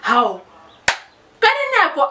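usual speaking voice

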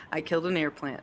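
teasing mock quote. Host teasing the Master Gardener by quoting her. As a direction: rib her by repeating her words. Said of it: "Master Gardener".

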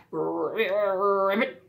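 a frog's croak, voiced as a word. A narrator's reading. Frog greeting the duckling with a croak. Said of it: "croaked a frog".

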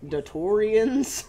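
mock drawn-out quote. Mocking a repeated game line.

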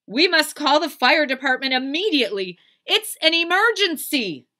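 high, loud panicked declaration; Mister Watson. Acted. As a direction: sound the alarm at full voice. Husband declaring an emergency.

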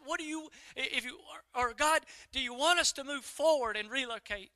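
re-enacted anxious prayer; pleading prayer. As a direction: plead for direction, questions tumbling out. Acted prayer, asking God for direction.

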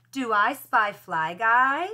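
"Do I spy Fly Guy?" slow, rising on "Guy"; questioning tune. Shown as a demonstration. Teacher shows children how a question sounds, voice rising on "Guy". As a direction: lift "Guy" at the end to ask. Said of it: "I changed my voice a little bit when I was asking a question".

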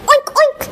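high-pitched imitated oinks; pig oink. Bunny sounding like a pig, high oinking. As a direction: oink twice, quick and high. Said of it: "sounding like a pig".

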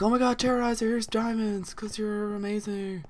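high, put-on gushing voice; mock adoring fans. Host imitating friends handing him diamonds, high mock-adoring voice. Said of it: "They were like".

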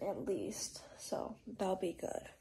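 slow soft whisper. Real, not acted. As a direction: whisper it sleepily at bedtime. Whispered goodnight, bedtime.

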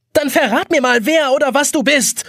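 very high exasperated shout; screaming. A narrator's reading. Jonas demanding to know who speaks, high angry scream. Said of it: "schreit Jonas".

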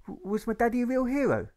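put-on high, stammering child asking shyly; a small boy daring to ask about his dad; hopeful little boy. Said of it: "Alfred once tried".

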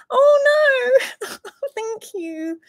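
a high, loud cry of being moved; overwhelmed by a kind gift; touched squeal. Real, not acted. Streamer thanking a viewer for a tip, touched and squealing high. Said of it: "I'm gonna get emotional".

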